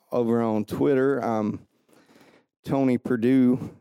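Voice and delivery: ordinary talk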